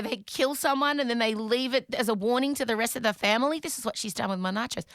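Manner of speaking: ordinary delivery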